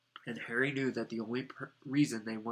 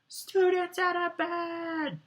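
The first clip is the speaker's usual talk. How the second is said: loud, very high, drawn-out alarm call; bellowing; 6 dB louder, much slower than usual. A narrator's reading. Peeves raising the alarm on students, bellowing. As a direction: bellow it high and long for the whole castle. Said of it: "Peeves bellowed".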